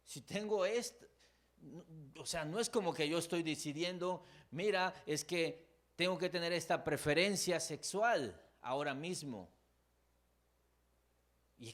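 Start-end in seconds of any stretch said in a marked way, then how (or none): 0.0-9.5 pleading struggler. Retold or imitated. Voices someone defending their struggle.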